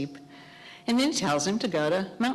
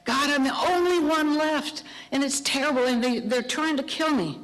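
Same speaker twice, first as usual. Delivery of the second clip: whiny complaint; 8 semitones higher than usual. Retold or imitated. Elijah repeats his complaint to God, whining. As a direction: wail it to God, pitched high.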